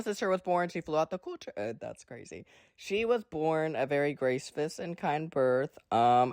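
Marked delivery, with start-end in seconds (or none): none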